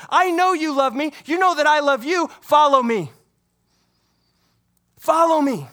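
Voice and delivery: preacher rousing an Easter congregation; preaching at full force. Real, not acted. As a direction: drive the message home to the congregation.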